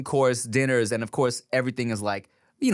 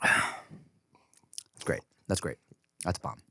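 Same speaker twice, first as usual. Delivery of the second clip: whispering; 7 semitones lower, 6 dB quieter, much slower than usual. Real, not acted. Savoring sake.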